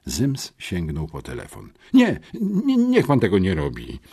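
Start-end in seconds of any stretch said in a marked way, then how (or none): 1.9-4.0 put-on hurried, scared plea of two boys; alarmed boys pleading with the officer; frightened plea. A narrator's reading. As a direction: beg him not to call, afraid. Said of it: "przestraszyliśmy się".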